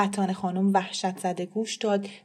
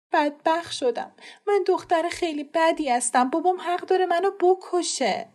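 higher, tearful self-blame; a girl sobbing out her shame; despairing girl; 9 semitones higher than usual. Acted. (دختر ناامید و گریان)

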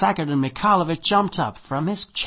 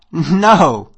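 snorting with laughter; 2 semitones higher, 13 dB louder than usual. A narrator's reading. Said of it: "snorting with laughter".

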